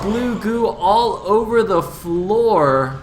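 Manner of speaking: high, loud climactic esports call, easing at the end; full-voice battle commentary, slowly coming down; shouted play-by-play. Real, not acted. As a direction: shout the carnage in drawn-out phrases.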